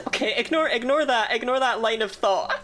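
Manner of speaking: giggling through the words; laughing. Real, not acted. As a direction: laugh while brushing it off.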